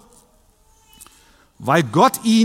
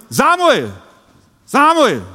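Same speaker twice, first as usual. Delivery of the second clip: a loud call of the name across the dark; loud summoning call; 7 semitones higher, 4 dB louder than usual. Acted. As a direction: call him from afar, rising then falling. Second call in the night.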